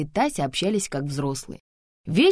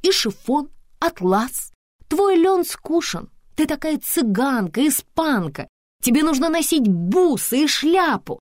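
high, loud, emphatic fashion advice; opinionated Antonina, enthusiastic; 12 semitones higher, 6 dB louder, slower than usual. Acted. Antonina restyling her friend, high and enthusiastic. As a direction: proclaim each item, pitched right up.

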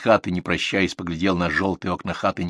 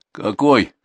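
louder, higher smirking question; mocking; 4 semitones higher, 6 dB louder than usual. A narrator's reading. Viktor answers the manager's reproach, mocking. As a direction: toss it back with a smirk. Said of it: "с усмешкой спросил Виктор".

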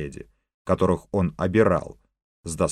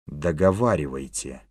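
a hint of a menacing villain hiss; 4 semitones lower than usual. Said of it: "зловеще прошипел король".

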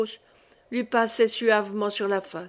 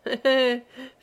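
put-on girlish laugh; 3 semitones higher than usual. A narrator's reading. Old wife laughs like a girl over their win. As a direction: giggle like a naughty little girl. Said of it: "avec un rire de gamine".

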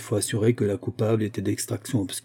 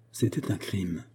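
low-key, softer falling close; grave admission; 3 semitones lower, 7 dB quieter, slower than usual. A narrator's reading. Daughter confessing her silence to her mother, soft and grave. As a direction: let the confession sink softly.

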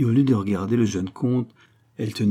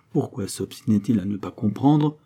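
ordinary delivery, nothing marked

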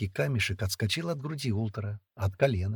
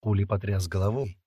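usual speaking voice

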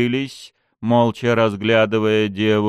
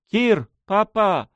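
hesitant call; 12 semitones higher, 4 dB louder, slower than usual. Acted. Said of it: "неуверенно позвала она".

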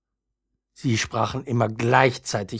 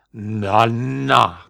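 louder, drawn-out grumble of reproof; grumbling rootstock; 6 dB louder than usual. A narrator's reading. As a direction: grumble a warning up from the ground. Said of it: "brummte der Wurzelstock". (brummiger, mahnender Wurzelstock)

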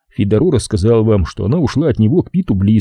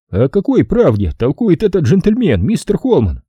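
usual speaking voice

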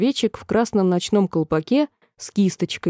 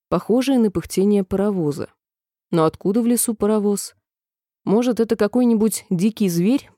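plain reading voice

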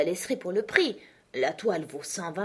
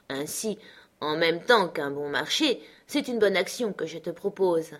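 ordinary delivery, nothing marked

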